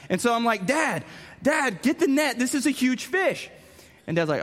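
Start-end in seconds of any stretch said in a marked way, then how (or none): 0.7-3.5 excited call to dad. Retold or imitated. Kid calling dad to get the net, urgent and excited. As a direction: yell for Dad to grab the net.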